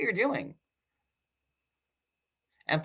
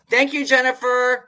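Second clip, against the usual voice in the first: high laughing thanks, much higher and louder; laughing thank you; 10 semitones higher, 6 dB louder than usual. Real, not acted. Thanking a commenter by name, laughing high.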